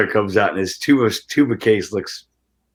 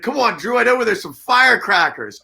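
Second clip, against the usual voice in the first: eager barback; 12 semitones higher than usual. Retold or imitated. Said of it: "And he's like".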